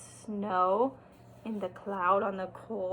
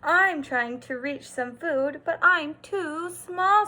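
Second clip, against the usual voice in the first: Mouse; 4 semitones higher, 7 dB louder, slower than usual. A narrator's reading. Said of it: "said Mouse".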